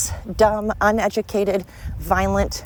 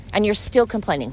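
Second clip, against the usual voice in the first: ordinary talk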